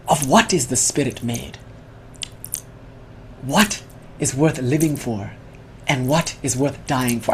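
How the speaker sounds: soft, slow mock Spanish accent with long pauses; hushed and unhurried, a lightly Spanish-accented philosopher; quiet Spanish-accented musing, slow and grave. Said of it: "he says in his mock Spanish accent".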